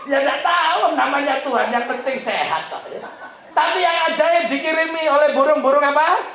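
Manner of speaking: usual speaking voice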